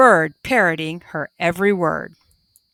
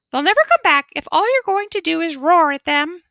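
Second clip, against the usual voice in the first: parrot voice; 8 semitones higher, faster than usual. A narrator's reading. Parrot speaks.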